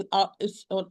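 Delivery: ordinary talk, nothing marked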